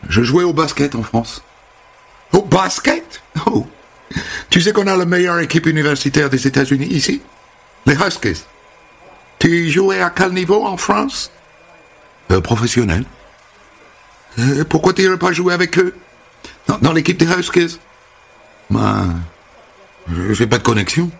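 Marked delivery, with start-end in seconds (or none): 2.3-11.3 high, gushing hairdresser greeting; sing-song high welcome; bubbly American hairdresser. Acted. Holly chats about basketball. 14.4-17.8 high, gushing hairdresser greeting; sing-song high welcome; bubbly American hairdresser. Acted. Holly suggests joining.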